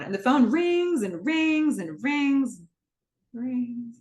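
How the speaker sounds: slow, stretched repetition of rings; drawn out. Retold or imitated. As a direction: let each rings hang longer. Drawn-out ringing in a retold joke.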